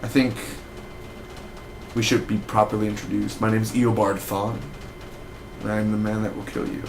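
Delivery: lightly voiced villain, hushed; understated sinister introduction; villain reveal. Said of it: "come out one day and be like".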